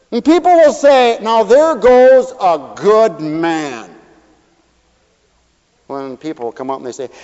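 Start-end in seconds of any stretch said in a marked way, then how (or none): 1.2-3.9 very slow, loud admiring line; admiring praise. Retold or imitated. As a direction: savor each word of praise.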